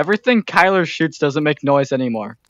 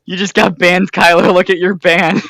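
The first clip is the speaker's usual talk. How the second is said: gleeful; 4 semitones higher, 6 dB louder than usual. Real, not acted. Gloating over a friend's ban.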